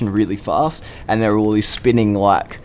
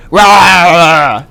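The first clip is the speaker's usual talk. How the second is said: loud, higher jump-scare roar; mock monster roar; 5 semitones higher, 9 dB louder than usual. Imitating the attraction's guy springing a jump scare, mock roar. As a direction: roar to make someone jump. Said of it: "shine it on his face and go".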